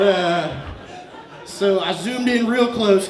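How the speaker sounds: ordinary talk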